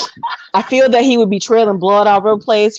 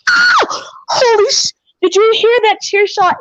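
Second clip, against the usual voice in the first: high startled cry at the hit; shocked yelp; 12 semitones higher than usual. Acted. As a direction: jump in shock at the chair shot. Commentator reacts to chair shot.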